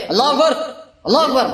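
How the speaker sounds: imitating a pushy worshipper; commanding call. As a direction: bark it at the imam as a command. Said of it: "یہ حکم ہے".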